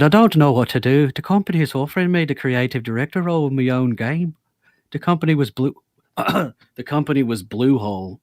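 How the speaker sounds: put-on loud, hammy Irish accent. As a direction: read it like a boisterous Irishman. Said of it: "You do the best Irish accent in here".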